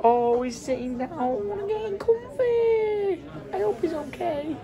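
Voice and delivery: high, slow, soft cooing. Real, not acted. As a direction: coo over him sweetly. Cooing over the hitchhiker.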